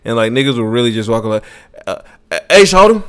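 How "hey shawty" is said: put-on high, loud "Hey, shawty" as a guy hitting on a girl; mock pickup line. Mimicking a street guy calling to a girl, high and loud. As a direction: call out like a guy trying his luck. Imitating guys approaching women.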